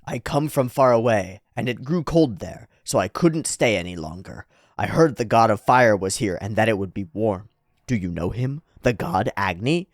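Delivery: low, measured character voice; solemn foreign lady. Acted. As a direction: tell your journey gravely and evenly. Voices flying lady from afar.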